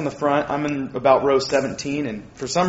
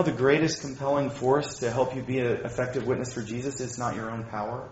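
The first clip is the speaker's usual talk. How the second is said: normal talking voice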